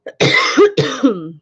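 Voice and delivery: coughing fit; dusty throat cough; coughing on dust. Real, not acted. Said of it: "I think I'm choking on dust".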